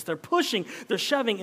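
ordinary delivery

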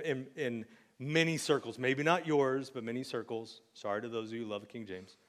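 ordinary delivery

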